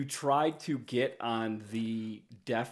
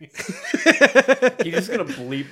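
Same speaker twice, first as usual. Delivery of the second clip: slow and laughing, louder; 2 semitones higher, 9 dB louder, much slower than usual. Real, not acted. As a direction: chuckle through the line. Laughing at the edit joke.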